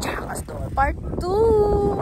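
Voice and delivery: high, slow announcer lilt; sing-song title. Acted.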